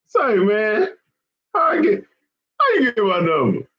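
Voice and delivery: high-pitched, cracking mock disbelief; mock outrage, high. Asking a live commenter how he got his number, in high mock shock. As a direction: squeal the question in fake shock.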